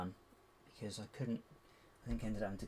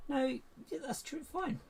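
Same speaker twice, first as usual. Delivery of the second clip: high exasperated reply; slower than usual. Real, not acted.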